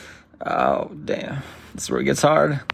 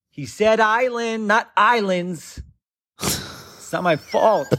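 mock bickering; 7 semitones higher, slower than usual. Retold argument in kidnapping.

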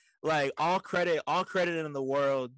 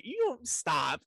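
ordinary talk

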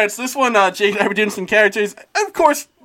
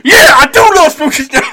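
loud, gruff wrestler growl; Macho Man Randy Savage; 6 semitones higher, 12 dB louder than usual. Acted. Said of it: "Like Macho Man Randy Savage".

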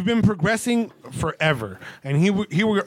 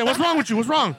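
retold alarm; 7 semitones higher than usual. Acts out his alarmed question.

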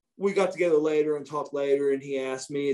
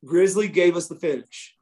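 usual speaking voice

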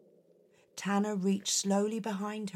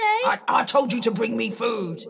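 fast, loud, irritated order; 4 semitones higher, 6 dB louder, faster than usual. Acted. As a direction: snap the order impatiently. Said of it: "Stop that".

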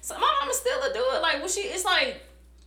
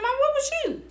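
much higher, demanding; mom, what was you, pressed hard; agitated question; 13 semitones higher than usual. Retold or imitated. Daughter demanding where her mom was, agitated. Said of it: "I was like".

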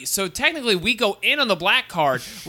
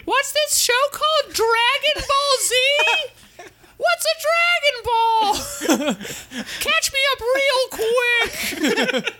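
very high, baffled squeaky voice; high clueless voice. Retold or imitated. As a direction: squeak out confused questions. Voicing confused viewer characters.

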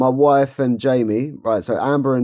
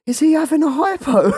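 high, laughing squeal; laughing question; 13 semitones higher than usual. Retold or imitated. Jamie asking Amber about the worker, laughing high. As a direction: ask it while cracking up. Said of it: "she goes".

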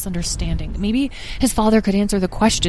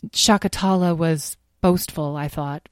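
ordinary delivery, nothing marked

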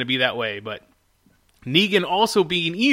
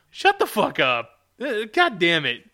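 exasperated rant; 4 semitones higher than usual. Real, not acted. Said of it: "you just fucking rant about characters".

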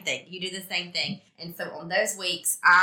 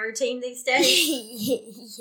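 words stretched out by laughter, pitched up; amused laughing; 5 semitones higher, much slower than usual. Real, not acted. Parent teasing kid about skincare routine, laughing. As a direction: break into a laugh mid-question.

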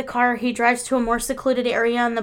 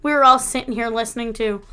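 ordinary talk

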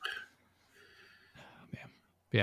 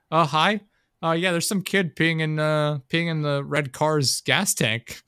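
higher, louder fake-polite phone voice, slowing at the end; mock innocent caller; 6 semitones higher, 4 dB louder, slower than usual. Calling the front desk to snitch on the kid, mock innocent. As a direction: play a falsely helpful tattletale on the phone. Imitates sweet phone complaint.

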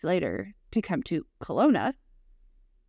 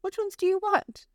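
Nana's voice; 13 semitones higher than usual. Retold or imitated. Nana voiced in retold story.

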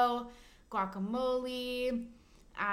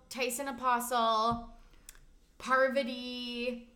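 ordinary talk, nothing marked

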